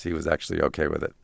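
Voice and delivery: normal talking voice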